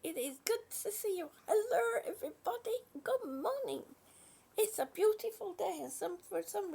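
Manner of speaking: very high, squealy sweet greeting voice; sing-song greeting. Acted. Greeting a chat viewer Lee, high and gushing. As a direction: gush a hello up high.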